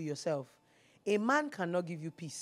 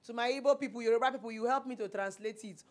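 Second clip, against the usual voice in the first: ordinary talk, nothing marked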